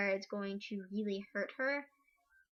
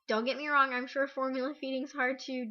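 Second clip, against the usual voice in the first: ordinary delivery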